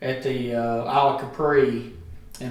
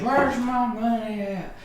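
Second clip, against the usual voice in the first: put-on high, slow slurred drunk voice; drunk dad; 8 semitones higher, slower than usual. Drunk dad asking his son for winnings: slow, high slurred. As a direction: play the drunk father asking for cash. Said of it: "And he goes".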